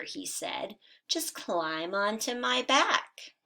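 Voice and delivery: lively storybook read-aloud for first graders; animated children's story narration. A narrator's reading. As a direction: read it like a bedtime picture book, bright and animated. Teacher reads picture book aloud.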